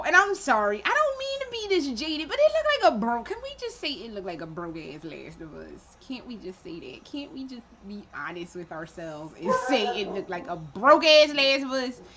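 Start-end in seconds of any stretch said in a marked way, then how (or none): 3.2-9.3 much softer, lower confession; sheepish low-voiced jab; muttered aside. Real, not acted. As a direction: drop the voice to admit it. Conceding an honest jab.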